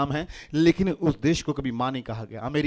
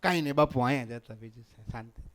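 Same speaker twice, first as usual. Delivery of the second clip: soft, lower, easygoing answer; affectionate and unbothered; loving reply; 4 semitones lower, 5 dB quieter than usual. Acted. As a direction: trail down gently, all at peace. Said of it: "એવો પ્રેમથી જવાબ આપે".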